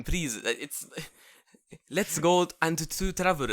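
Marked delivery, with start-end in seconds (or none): 1.9-3.5 slow mock Japanese accent; put-on Japanese accent, drawn out. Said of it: "it's not gonna fucking work".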